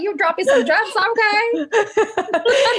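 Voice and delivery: high-pitched, giddy mock praise; playful exclamation. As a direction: squeal it playfully, pitch way up, laughing at the end. Playfully quoting her own reaction, laughing.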